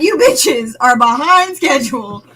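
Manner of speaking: loud, slow, drawn-out telling-off; mock scolding. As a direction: play at berating them, loudly and slowly. Acting out an impatient scolding quote.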